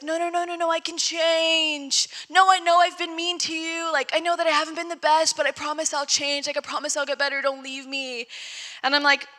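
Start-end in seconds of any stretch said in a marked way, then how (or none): 0.0-8.3 pleading ex. Retold or imitated. Ex begging not to be left.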